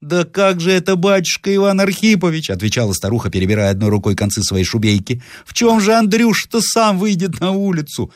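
the wife protesting to her husband; high fretful old woman. Acted.

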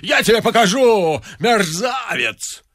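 a high, sharp shout; the locksmith yelling after the procession; shouted insult. Acted. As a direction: fling the word after them. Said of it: "закричал Виктор Михайлович".

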